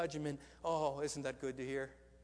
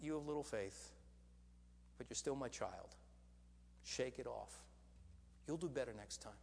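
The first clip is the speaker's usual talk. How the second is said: gentle Jesus; 4 semitones lower, 6 dB quieter than usual. Retold or imitated. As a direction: lower and softer, tender reassurance. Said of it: "I hear Him saying something like".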